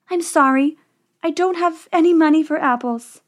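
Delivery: put-on high-pitched regretful girl. A narrator's reading. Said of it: "she said".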